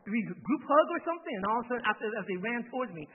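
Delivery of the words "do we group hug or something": excited. Retold or imitated. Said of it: "I'm like".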